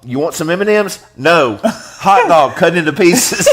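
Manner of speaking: mock family exchange. Parent and kid exchange.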